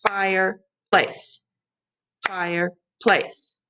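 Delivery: "Fireplace" slow, split into two beats: fire, place; clapped syllables. Shown as a demonstration. Teacher clapping syllables with students, slow. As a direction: clap it out slowly syllable by syllable. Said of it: "let's count the syllables".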